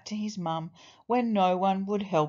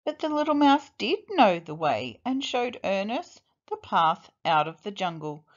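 plain reading voice

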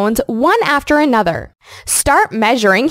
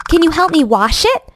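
ordinary delivery

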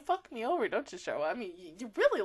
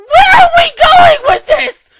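very high, slow, much louder wailing question; crying shout; 23 dB louder than usual. Real, not acted. Fan yelling at the show, crying shout. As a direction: howl it at the show. Upset at the plot.

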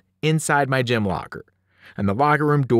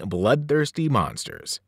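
ordinary delivery, nothing marked

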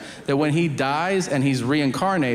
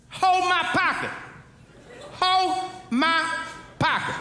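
high, put-on convict voice; prisoner's command; 13 semitones higher than usual. Next prisoner makes kids hold his pocket, high put-on command. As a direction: order it in a high-pitched character voice, pausing between words.

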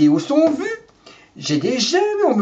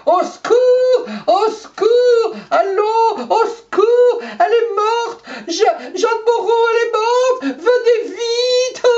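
hysterical maid on the phone; slower than usual. Acted. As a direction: scream down the phone in mock panic. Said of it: "elle a pas crié comme ça, comme Tarzan".

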